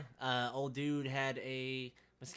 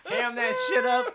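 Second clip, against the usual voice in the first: loud hype; 11 semitones higher, 12 dB louder, slower than usual. Real, not acted. Egging friend on loudly.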